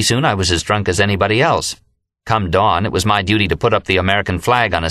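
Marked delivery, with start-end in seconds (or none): none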